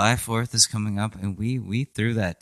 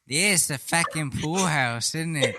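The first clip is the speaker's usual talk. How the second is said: bad British accent; 6 semitones higher, 3 dB quieter than usual. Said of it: "a really bad British accent".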